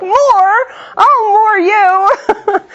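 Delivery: high, slow, laughing mock wail; mock exasperated cry. Mother retelling her toddler's demand, mock wailing. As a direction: wail it out in fake despair, laughing.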